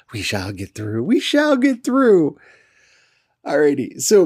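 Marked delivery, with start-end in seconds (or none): 0.1-1.0 low soft "We shall get through" with a rising "through"; quiet mutter. Real, not acted. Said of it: "repeats phrase two ways". 1.0-2.4 high, loud, slow "We shall get through" with a falling end; grand proclamation. Real, not acted. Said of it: "repeats phrase two ways".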